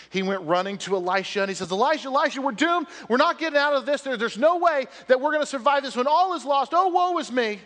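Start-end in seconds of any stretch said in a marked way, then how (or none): 1.7-7.6 high, loud mock panic; terrified. Panicked servant runs to Elisha with news of doom, hammed-up terror. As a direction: wail it in hammed-up despair. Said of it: "he was terrified".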